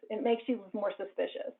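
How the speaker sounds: ordinary talk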